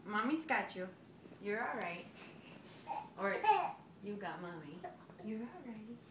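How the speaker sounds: soft, low reassuring words. Real, not acted. Comforting wobbling toddler.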